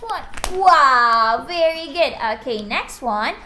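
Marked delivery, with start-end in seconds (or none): 0.6-2.1 loud wow of praise; excited praise. Real, not acted. Praising child's answer.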